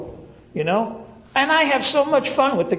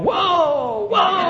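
yelling whoa; 8 semitones higher than usual. Retold or imitated.

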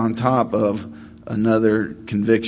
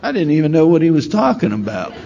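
ordinary talk, nothing marked

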